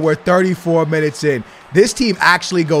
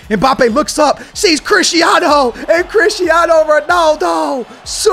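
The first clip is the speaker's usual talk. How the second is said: slow, high, building call; rising goal call; 8 semitones higher, 3 dB louder than usual. Real, not acted. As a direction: stretch the name as the goal comes.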